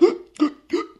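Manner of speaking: sharp high gasp for air, imitated; mock drowning gasp. Retelling drowning to the host, a sharp imitated gasp. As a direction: gulp in air like water fills your lungs.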